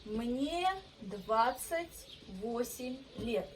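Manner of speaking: Мне двадцать восемь лет said slowly, word by word; slow Russian model sentence. Shown as a demonstration. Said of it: "Мне means I'm".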